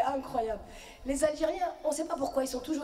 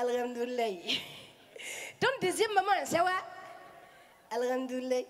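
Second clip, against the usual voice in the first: a hammed-up Malian accent, smiling greetings; slower than usual. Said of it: "ça donne, euh".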